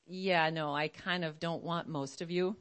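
low, hushed and deliberate; solemn divine demand with long pauses; voice of God. Acted. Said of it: "the Lord is like".

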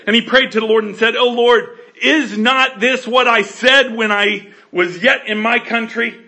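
loud preaching read; forceful scripture reading. Acted.

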